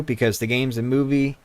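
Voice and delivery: ordinary talk, nothing marked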